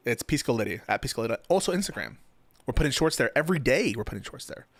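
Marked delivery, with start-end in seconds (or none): 4.0-4.7 soft, dropped-off repeat; quiet aside. Shown as a demonstration. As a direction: say it again under your breath. Repeating plug, second time softer.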